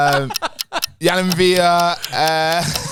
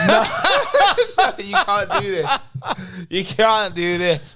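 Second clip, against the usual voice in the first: higher-pitched, laughing complaint; laughing protest; 7 semitones higher, 4 dB louder than usual. Real, not acted. Player protesting to the quizmaster, laughing. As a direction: plead through helpless laughter.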